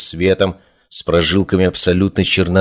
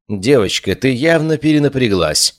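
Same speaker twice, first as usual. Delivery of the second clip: high, cool condescension; cold; 7 semitones higher than usual. A narrator's reading. Elara dismisses the girl's accusation, cold. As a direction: dismiss her coldly. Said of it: "холодно сказала Элара".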